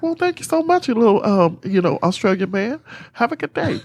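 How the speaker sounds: high, sugary thank-you; mock sweet thanks. Replying to Matthew's advice in a high mock-sweet voice. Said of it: "I was like".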